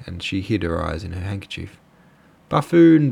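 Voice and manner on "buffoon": loud, high burst of scorn; sudden high-pitched exclamation; blurted out. A narrator's reading. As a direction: blurt the insult out sharply. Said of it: "blurted out the girl".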